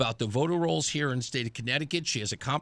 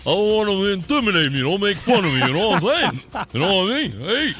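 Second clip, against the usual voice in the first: loud, slurred, higher mock-Rocky voice; Sylvester Stallone; 4 semitones higher, 5 dB louder than usual. Host kidding traffic reporter as Sylvester, garbled Rocky impression. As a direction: garble it like a punchy Italian-American boxer. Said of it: "nobody has any idea what he's saying".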